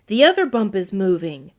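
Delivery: ordinary delivery, nothing marked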